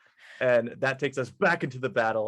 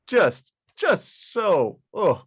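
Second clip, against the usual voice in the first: high slow stammer; 8 semitones higher, 3 dB louder, much slower than usual. Acted. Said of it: "She goes".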